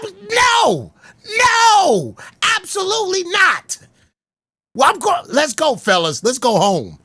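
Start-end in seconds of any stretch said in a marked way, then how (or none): 0.0-3.8 emphatic refusal. Real, not acted. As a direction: shut it down hard. Firm refusal.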